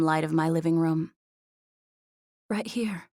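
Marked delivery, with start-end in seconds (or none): 2.5-3.1 soft, higher, breathless reply. A narrator's reading. Woman to the man inches away, breathless. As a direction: answer breathlessly, barely above a murmur. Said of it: "I said breathlessly".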